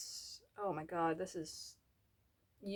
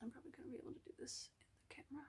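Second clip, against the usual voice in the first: fast breathy whisper to herself; 5 semitones higher, 16 dB quieter, much faster than usual. Real, not acted. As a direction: mutter it under your breath, quick. Muttered aside while doing makeup.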